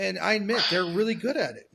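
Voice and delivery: usual speaking voice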